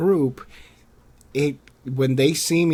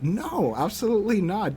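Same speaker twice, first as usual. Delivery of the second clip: firm refusal; 8 semitones higher than usual. Retold or imitated. Acts out correcting a bigot.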